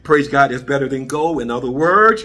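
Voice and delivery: ordinary delivery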